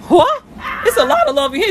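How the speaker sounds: high, loud "What?"; playful startled yelp; mock surprise. Real, not acted. Reacting to child in bed.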